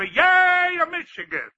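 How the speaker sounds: high shout on Yay, then fading; demo cheer. Shown as a demonstration. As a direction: show him how a college man cheers. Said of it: "let me hear you yell".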